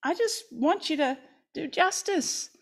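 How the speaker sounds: tender mother. Acted. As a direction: hold the child close and reassure. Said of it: "feel the mother embracing the son".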